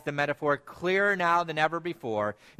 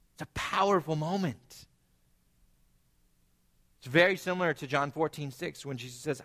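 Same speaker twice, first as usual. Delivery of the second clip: normal talking voice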